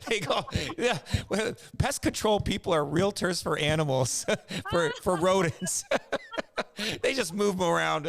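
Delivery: high-pitched, laughing delivery; real laughter bubbling between phrases. Real, not acted. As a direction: crack up while telling the joke. Cracking up at own joke.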